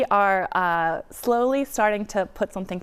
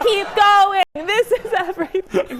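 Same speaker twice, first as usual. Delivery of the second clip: excited; 12 semitones higher, 7 dB louder than usual. Retold or imitated. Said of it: "And I said".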